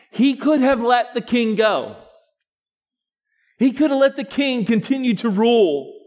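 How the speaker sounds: loud, high preaching, building; full-force preaching. Acted. Pastor rousing the congregation with full preaching. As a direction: preach it out to the church.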